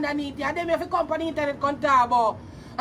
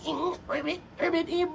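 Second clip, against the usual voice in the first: a soft croaked "ribbit" aping a frog; mock frog croak; 7 dB quieter than usual. Mocking the rival's frog voice with a soft croak. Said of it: "frog face and frog voice".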